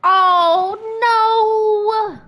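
loud, high drawn-out no. Real, not acted. Gamer watching her character die, loud high howl. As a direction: howl at the screen.

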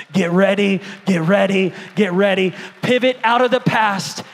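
loud, rising call to the church; preaching shout. Real, not acted. Preacher shouting at his church to get ready. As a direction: fire up the congregation.

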